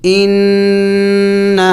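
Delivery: ان with the doubled نون hummed through the nose; ان ringing in the nose; nasal ghunna. Shown as a demonstration. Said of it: "ناک میں آواز پیدا کرنا ہوگا".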